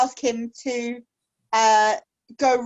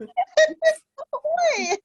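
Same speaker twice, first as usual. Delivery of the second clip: very high drawn-out ahoy; high elf voice. Acted. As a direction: sing out the hail high. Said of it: "because she's a high elf".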